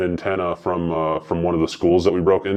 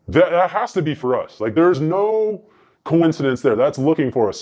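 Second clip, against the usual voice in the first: alarmed; 7 semitones higher than usual. Retold or imitated. Retelling his alarmed realization.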